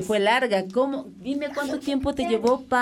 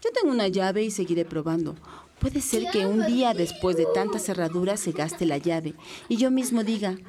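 poem reading; 3 semitones lower, 4 dB quieter than usual. Acted. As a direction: read the poem gently and thoughtfully. Reads a poem aloud. (lectura de poema pausada)

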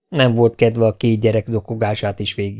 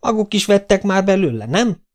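magas hangon rikácsoló öregasszony; kérdezz vissza rikácsolva; 9 semitones higher than usual. A narrator's reading. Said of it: "rikácsolta az öregasszony". (öregasszonyos rikácsolás)

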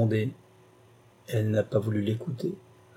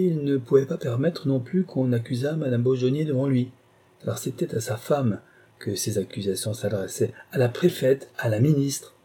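ordinary delivery, nothing marked

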